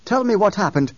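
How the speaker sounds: high, loud demand; confronting. A narrator's reading. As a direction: press her hard for answers. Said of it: "Halleck confronted Sabiha".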